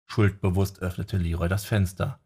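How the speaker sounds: plain reading voice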